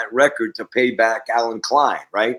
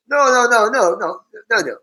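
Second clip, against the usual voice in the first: high, loud burst of no's; acted-out insistence, tumbling over itself; urgent protest; 11 semitones higher, 4 dB louder than usual. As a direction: cut in high to stop someone leaving. Acts Lennon stopping him from leaving.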